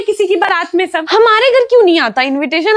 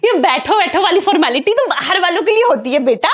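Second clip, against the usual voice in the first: usual speaking voice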